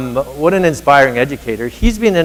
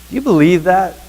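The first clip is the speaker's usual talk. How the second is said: ordinary delivery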